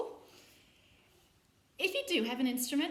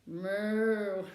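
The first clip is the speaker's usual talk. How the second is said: a loud mooing cow; 3 semitones lower, 6 dB louder than usual. Shown as a demonstration. Showing the children what a cow says, loud moo. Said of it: "That's right, it's a cow".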